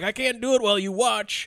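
high, mildly hammed-up protest; mock whiny complaint. Reluctant killer to the watching woman, mock whiny complaint. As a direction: complain like someone put off by an audience.

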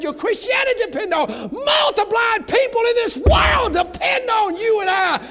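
rising, shouted revival preaching; preaching at full force. Acted.

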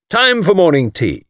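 rally the party briskly. A narrator's reading. Anthony calling tourists to tea, brisk. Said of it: "said Anthony briskly".

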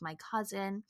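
ordinary talk, nothing marked

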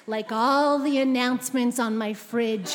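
usual speaking voice

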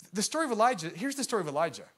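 ordinary talk

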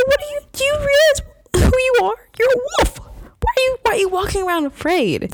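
high-pitched mock disbelief; incredulous scolding. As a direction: squeal it at a cowering wolf in disbelief. Acting out disbelief at cowering wolf.

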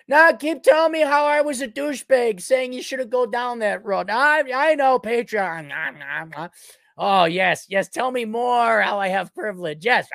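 high-pitched taunting; mocking high voice. Taunting the Patreon critics in a high mocking voice.

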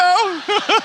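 high giggle; real amused laugh; a short high chuckle. Real, not acted. Laugh after retold request.

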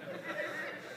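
a short high laugh at his own joke; quick laugh. Real, not acted. Preacher laughs at his own lamb chops joke, short laugh. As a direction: break into a little laugh. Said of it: "Sorry, I couldn't resist".

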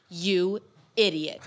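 drawn-out mock "You idiot"; slow mock scolding. Sister scolding her pregnant sibling, slow mock scorn. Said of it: "And she's like".

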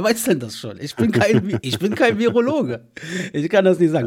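laughing. Real, not acted. Joking remark, laughing.

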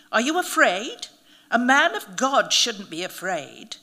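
loud mocking taunt. A narrator's reading. Narrator goading the priest about fear, taunting. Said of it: "I s-, taunted".

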